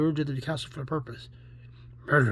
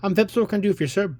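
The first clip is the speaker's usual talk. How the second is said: raised, louder official reply; brisk inspector; 6 semitones higher, 6 dB louder, faster than usual. Acted. Inspector answering an angry caller, loud and brisk. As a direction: answer firmly as the officer in charge.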